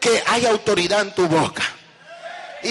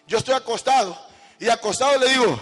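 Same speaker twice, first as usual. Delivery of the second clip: ordinary talk, nothing marked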